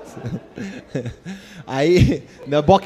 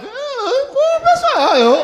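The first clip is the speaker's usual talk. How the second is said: Shaggy voice crack; 4 dB louder, much slower than usual. Acted. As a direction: voice cracking way up, guilty teen. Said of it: "eu virei um salsicha".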